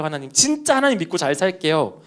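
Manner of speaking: usual speaking voice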